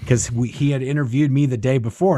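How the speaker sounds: ordinary talk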